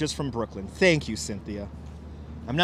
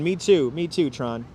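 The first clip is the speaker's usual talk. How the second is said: usual speaking voice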